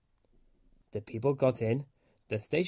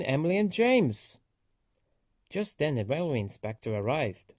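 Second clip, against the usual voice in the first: ordinary delivery, nothing marked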